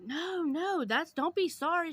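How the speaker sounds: high, gushing imitated voice; gushing reassurance. Imitating the beggar brushing off the apology, high gushing voice. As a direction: mimic her over-sweet reply. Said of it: "she was like".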